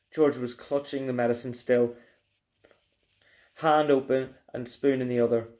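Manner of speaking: plain reading voice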